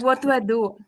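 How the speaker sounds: ordinary delivery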